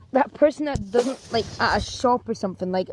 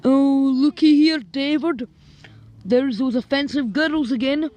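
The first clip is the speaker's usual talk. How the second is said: loud, high taunting voice; mocking bully; 5 semitones higher, 7 dB louder than usual. Girl taunting the boys from Scotland, loud mock. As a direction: sneer loudly at the newcomers. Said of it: "what kinda happened".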